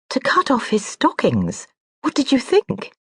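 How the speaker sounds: rising, exasperated snap; a sharp, impatient question. Acted. Sister snapping at the brother's man, sharp. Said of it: "the sharp tone".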